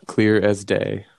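drawn out slowly; slow emphatic. Real, not acted. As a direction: stretch each word for emphasis.

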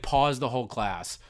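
ordinary talk

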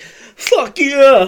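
loud, high burst of eager agreement; a shouted fuck yeah; excited yes. Retold or imitated. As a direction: blurt it out like a hooked kid. Eager answer to cigarette offer.

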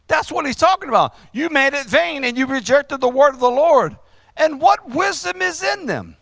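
ringing, insistent sermon delivery; emphatic preaching. Real, not acted. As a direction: press the accusation on the congregation.

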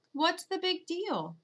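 high, drawn-out innocent question. A narrator's reading. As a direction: play the little sister acting innocent. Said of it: "gives us an innocent look and says".

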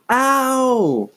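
high sharp cry of pain; pained yelp. A narrator's reading. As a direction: yelp as if slapped. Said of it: "Chris screamed".

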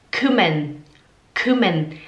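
kümmern said slowly, rounded ü; slow German demo. Shown as a demonstration. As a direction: model kümmern for students. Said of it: "Tipo aqui a palavra kümmern". (kümmern pronunciado devagar)

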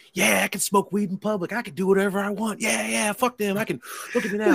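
high-pitched braggy imitation; high, cocky mock boasting; mock trash talk. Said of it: "Magoo's talking all this shit".

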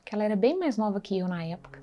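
ordinary delivery

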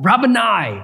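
an overjoyed cry of Rabboni. Acted. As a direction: call out Rabboni with sudden joy. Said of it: "she cries out".

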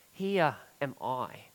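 Isaiah humbly giving himself up to God; humble surrender. Retold or imitated. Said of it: "sense here of total surrender".

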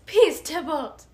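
loud slow call for peace; loud pleading shout. Acted. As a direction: cry out to stop the fight. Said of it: "Juliet says".

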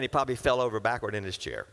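ordinary delivery

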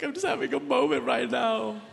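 hammed-up choked-up husband; mock crying. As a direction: imitate blubbering through an excuse. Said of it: "I'm literally crying".

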